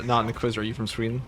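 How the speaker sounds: ordinary talk, nothing marked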